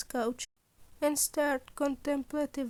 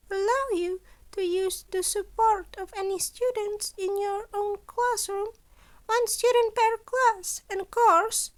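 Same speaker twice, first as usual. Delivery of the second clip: higher, louder voice for the cheerful scheming principal; principal Nezu; 8 semitones higher, 5 dB louder than usual. Acted. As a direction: play Nezu brightly pleased with his impossible demands. Nezu sets the limits.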